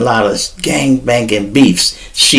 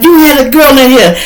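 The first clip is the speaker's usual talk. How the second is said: put-on accusing girlfriend; 11 semitones higher, 4 dB louder than usual. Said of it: "she like".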